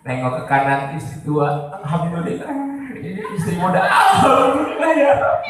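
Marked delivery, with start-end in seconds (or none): 1.8-2.5 soft, understated mock gratitude; a half-hearted little thanks, laugh nearby; muted thanks. Contrasting thanks for older wife. 3.8-5.5 high, hammed-up cry of gratitude; mock wailing with overdone joy; tearful thanks. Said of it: "Nangis saya".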